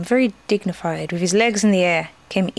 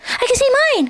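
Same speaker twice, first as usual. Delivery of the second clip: high, excited little Roo voice; excited cry; 4 dB louder, faster than usual. A narrator's reading. Roo spots a stick in the game, excited cry. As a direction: squeal it out in delight. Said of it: "cried Roo".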